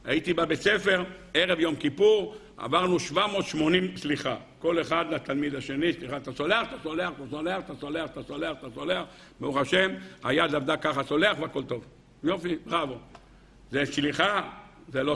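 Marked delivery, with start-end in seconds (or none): 5.9-9.0 low, softening repetitive mumble; mechanical apology. Retold or imitated. Schoolboy asking each classmate forgiveness before Yom Kippur, mechanical. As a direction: run down the line by rote, fading.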